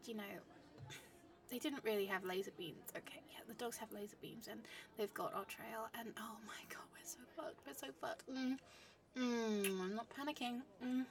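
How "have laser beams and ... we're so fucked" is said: soft unvoiced dread; hushed realisation; panicked whisper. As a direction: let the fear slip out quietly.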